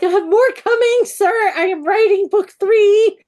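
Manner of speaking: loud, high comic protest; indignant mock shout. Author defending herself to an impatient reader, indignant mock shout. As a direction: shout it back in mock outrage.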